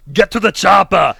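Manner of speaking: put-on loud, slow Austrian accent; Arnold Schwarzenegger. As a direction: bark it like an action hero. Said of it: "Give me your best Arnold Schwarzenegger".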